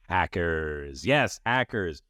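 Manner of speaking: ordinary delivery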